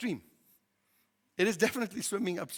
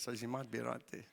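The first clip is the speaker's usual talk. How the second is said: much softer, half to himself; muttered concession; quiet self-deprecating admission; rueful aside; 7 semitones lower, 14 dB quieter than usual. Real, not acted.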